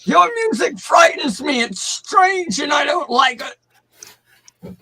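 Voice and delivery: put-on high, shouting old geezer; old man voice. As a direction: rant like a grumpy grandpa.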